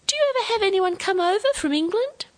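put-on like an adult cheering up a grumpy child; sing-song, forced brightness; overbright. A narrator's reading. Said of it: "Her voice was overbright".

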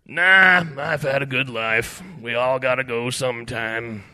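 loud, drawn-out, gravel-tinged resignation; gravelly large shrimp. A narrator's reading. Doomed shrimp reassures Ed about dying, gravelly. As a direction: shrug it off in a gravelly voice. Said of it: "with a hint of gravel in its tone".

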